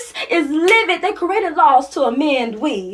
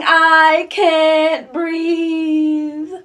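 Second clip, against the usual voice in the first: very drawn-out, stretched words; slow, agonizingly held final word; murmured dying plea; much slower than usual. Acted. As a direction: stretch each word out like a last breath.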